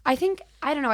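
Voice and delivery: ordinary delivery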